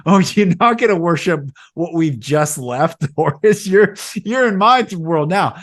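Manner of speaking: Moses scolding loud and high; angry Moses. Retold or imitated. Moses chewing out the people at Sinai, loud and high. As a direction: let Moses blow up at the people, mocking. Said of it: "He's like".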